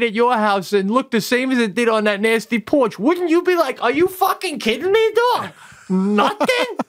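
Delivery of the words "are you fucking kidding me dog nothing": much higher, incredulous mock scolding; mock exasperation. Owner scolds the adopted dog, high and incredulous. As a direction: throw up your hands at the dog.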